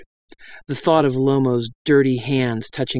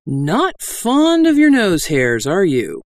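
casual, slow, higher taunt; conversational menace; 5 semitones higher, 3 dB louder, slower than usual. A narrator's reading. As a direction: threaten lightly as if chatting. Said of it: "Lomo said conversationally".

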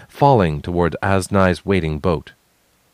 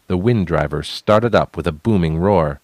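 ordinary delivery

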